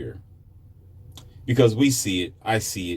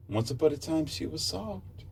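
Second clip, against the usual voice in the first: soft; 7 semitones higher, 8 dB quieter than usual. Shown as a demonstration. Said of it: "describing softness softly".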